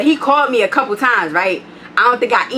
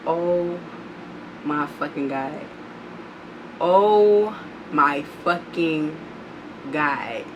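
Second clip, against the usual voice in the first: much softer, low, slow groan of dread; 6 semitones lower, 8 dB quieter, much slower than usual. Retold or imitated. Narrator seeing the man spot Legion, soft despair. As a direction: drag it out in quiet horror. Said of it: "I said".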